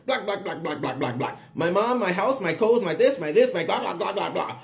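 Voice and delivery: mocking run-on blah-blah chatter; nonstop chattering neighbor. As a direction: imitate a neighbor who won't stop yammering. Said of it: "she just starts talking".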